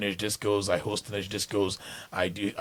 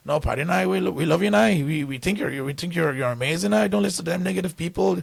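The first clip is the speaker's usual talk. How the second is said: put-on higher, louder Northern Irish accent; 8 semitones higher, 5 dB louder than usual. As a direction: imitate an excited Northern Irish fan. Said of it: "I hear the Northern Irish accent".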